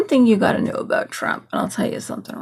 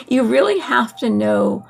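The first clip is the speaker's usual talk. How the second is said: ordinary talk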